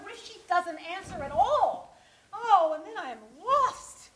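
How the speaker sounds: fretful and escalating; rising panic. Acted. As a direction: work yourself into a mild panic. Panicking over unanswered call.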